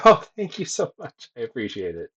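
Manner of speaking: normal talking voice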